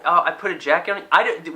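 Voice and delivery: normal talking voice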